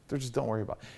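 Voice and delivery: very soft, hushed comforting; gentle reassurance. Retold or imitated. Father wiping his crying daughter's tears, softly reassuring. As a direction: soothe softly, almost under the breath.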